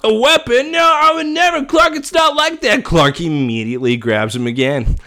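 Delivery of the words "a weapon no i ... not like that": high-pitched panicked pleading; high panicked plea. Retold or imitated. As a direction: shout it high and desperate. Mock Lionel pleading, shouting high.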